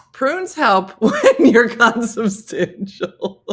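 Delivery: slow laughing mock chant.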